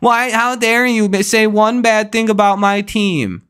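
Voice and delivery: mocking a crybaby fan in a high whine; mocking whiny fan. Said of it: "from people like you".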